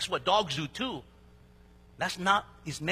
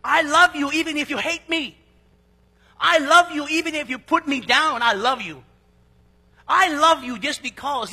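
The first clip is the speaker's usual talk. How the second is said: louder, higher, proclaiming love with full force; impassioned declaration; 7 semitones higher, 8 dB louder than usual. Acted. Preacher proclaiming unconditional love to the congregation, loud and high. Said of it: "Agape love means unconditional".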